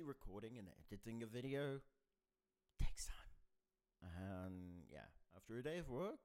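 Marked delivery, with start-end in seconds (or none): 2.8-3.3 breathy stage whisper; whispered aside. Real, not acted. Aside about editing effort.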